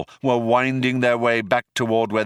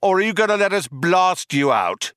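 high, calling threat; shouted; 9 semitones higher, 3 dB louder than usual. A narrator's reading. Cop threatens the cornered group, shouted. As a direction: shout the ultimatum across the room. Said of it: "shouted one of the cops again".

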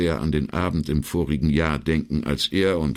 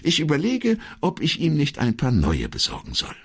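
higher-pitched secretary, falling away at the end; a hint of the secretary's higher voice; lightly voiced secretary; 11 semitones higher than usual. Secretary considers buying him some new ones, lightly voiced.

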